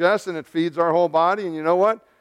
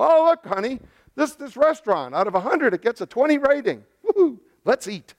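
high-pitched, hammed-up delight; mock excitement; 7 semitones higher than usual. Diner to honey over a terrible rating, mock excitement. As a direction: cheer for the awful restaurant in a higher voice.

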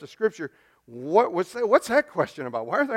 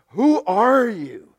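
slow, higher, louder demand; challenging delegation; 5 semitones higher, 5 dB louder, much slower than usual. Acted. Religious delegation confronting a believer, slow challenging demand. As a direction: put the question as a weighty challenge.

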